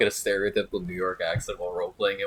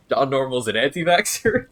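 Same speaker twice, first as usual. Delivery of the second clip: surprised; 5 semitones higher, 7 dB louder than usual. Real, not acted. Amused disbelief at suggestion.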